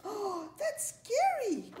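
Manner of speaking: high, drawn-out storybook dismay; scared reaction. A narrator's reading. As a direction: react with playful dread, slowly. Firefly reacts to frightening news.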